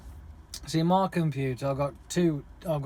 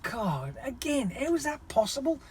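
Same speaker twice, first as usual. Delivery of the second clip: retold disbelief; 10 semitones higher than usual. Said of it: "I'm like".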